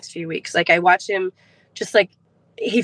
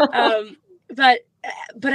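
choking up; 4 semitones higher, slower than usual. Real, not acted. Mother choking up while telling about her son. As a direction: voice catching on the edge of tears.

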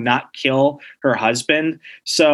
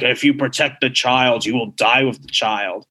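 dark demonic voice; 2 semitones lower than usual. Retold or imitated. Possessed Human Torch threatens whoever protects the child, dark demonic voice. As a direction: imitate a possessed villain's menace, understated. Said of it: "His voice is all dark, evil, and demonic".

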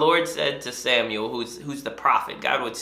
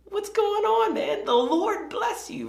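much higher, chummy and upbeat; cheesy Saul; 13 semitones higher, 3 dB louder than usual. Retold or imitated. Saul breezily greeting Samuel, high and chummy. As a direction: play a clueless king all smiles. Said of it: "So he says".

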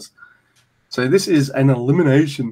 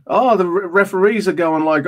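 normal talking voice

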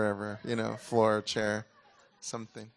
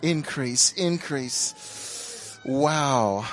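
preaching at full force, louder and higher, slow and drawn out; fervent prayer; 3 semitones higher, 6 dB louder, much slower than usual. Real, not acted. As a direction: cry out to heaven for the glory to fall. Prayer fading to hush.

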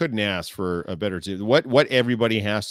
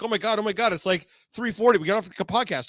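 mock panic; 9 semitones higher than usual. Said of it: "these monkeys start running around like".

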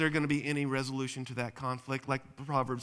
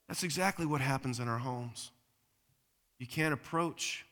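ordinary talk, nothing marked

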